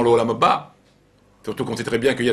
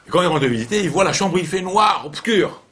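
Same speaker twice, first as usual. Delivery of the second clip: higher, louder storyteller building suspense; suspense narration; 7 semitones higher, 3 dB louder than usual. A narrator's reading. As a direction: paint the dark room, keep them hanging. Suspenseful storytelling of dark room.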